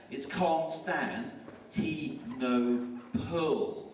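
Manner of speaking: It's Constantinople drawn out very slowly, syllable by syllable; slow spelled-out word. Retold or imitated. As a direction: stretch every syllable of Constantinople, mocking the teacher. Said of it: "Oh, it's easy, man".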